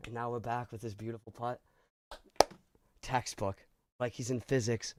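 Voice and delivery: hushed, low golf commentary; golf commentator. Acted. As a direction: murmur it like a golf broadcaster. Back from break, hushed commentary.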